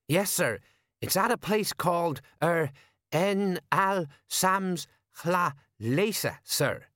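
high, halting sergeant sounding out a foreign name; earnest sergeant. Acted.

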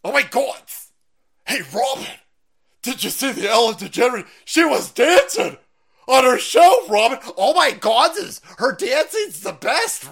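high, loud hammed-up gushing; Howard Stern impression. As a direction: squeal it like an excited gossip. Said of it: "degenerate leftard".

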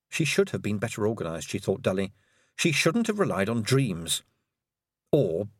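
ordinary delivery, nothing marked

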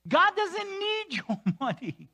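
high and slow with a laugh. Real, not acted. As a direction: laugh through the line. Laughing aside in sermon.

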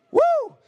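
sharp, high-pitched "woo"; fired-up falsetto whoop. Real, not acted. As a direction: let out an excited high holler.

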